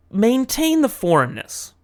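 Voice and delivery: emphatic. Real, not acted. Catchphrase advice, high pitch.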